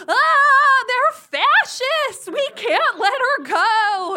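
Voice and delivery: mom's loud, high panicked wail; mock wailing. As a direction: wail it out, hammed-up and drawn out. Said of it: "My mom immediately starts wailing".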